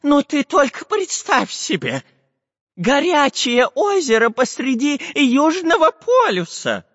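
high, slow, excited professor voice; too much caffeine. Acted. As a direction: gush the news in a high voice. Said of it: "протараторил Семён Семёныч".

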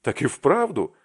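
loud, high astonished shout; shouting at the top of his lungs. A narrator's reading. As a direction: yell it out in disbelief. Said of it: "закричал благим матом Аркаша".

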